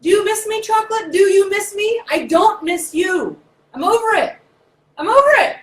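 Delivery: high, loud, teasing sing-song; loud high mock taunt; taunting chocolate voice. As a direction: taunt her like a smug ex. Talking to her chocolate craving.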